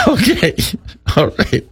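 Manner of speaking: bursts out laughing; can't hold back laughter. Real, not acted. Laughing at the headline.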